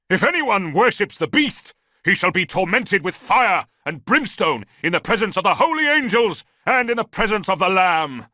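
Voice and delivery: loud, high thundering scripture; preaching shout. A narrator's reading. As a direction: hurl damnation at the soldier. Priest preaching amid the fight.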